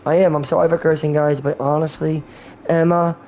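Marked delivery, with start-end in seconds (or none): none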